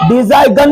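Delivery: "डिजाइन" said di-zai-gun, with a hard g; G wrongly sounded. Shown as a demonstration. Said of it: "डिजाइन नहीं पढ़ेंगे".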